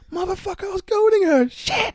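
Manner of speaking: shrill, very high panicked cry; retold panic. Guard realises he provoked a stabber, squealing panic. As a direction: squeal in hammed-up horror. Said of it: "I'm like".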